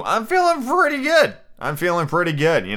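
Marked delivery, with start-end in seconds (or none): 0.0-1.4 much higher, pleased tipsy lilt; tipsy sing-song. Retold or imitated. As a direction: sing it out like a happy drunk. Retelling getting tipsy.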